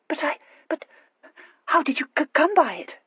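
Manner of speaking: high, halting, stammered astonishment; dumbfounded. A narrator's reading. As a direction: stumble over the question in bewilderment. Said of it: "quite dumbfounded".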